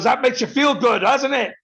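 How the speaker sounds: high, loud mocking jeer; gloating taunt. Bartender rubbing it in: high loud jeer. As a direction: rub it in with a sneer.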